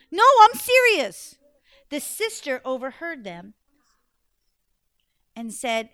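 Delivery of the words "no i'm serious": frightened insisting. Acted.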